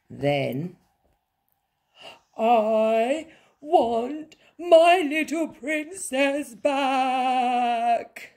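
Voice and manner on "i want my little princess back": high, loud, drawn-out bawl; hammed-up childish wail from a mother; wailing queen. A narrator's reading. Queen missing her princess, wailing.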